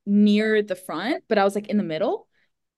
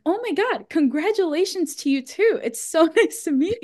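fake gushing; 8 semitones higher than usual.

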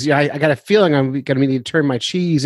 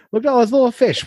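much higher cartoonish fish voice; imitating hungry fish; 10 semitones higher, faster than usual. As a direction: play an excited big fish, high-pitched. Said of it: "they come in and go".

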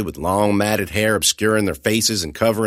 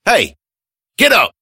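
shouting; 12 semitones higher, 7 dB louder than usual. Acted. Narrator shouts at still boy.

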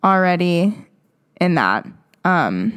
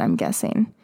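mumbled aside; 6 dB quieter than usual. Real, not acted. Trailing aside.

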